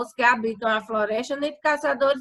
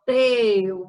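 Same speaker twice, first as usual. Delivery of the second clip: TEU stretched and stressed; said with more force; 3 dB louder than usual. Shown as a demonstration. Teacher demonstrating the tonic syllable, said with force. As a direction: lean hard and long on "teu". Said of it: "você já pronuncia ele mais força".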